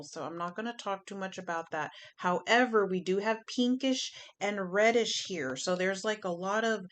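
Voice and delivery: ordinary delivery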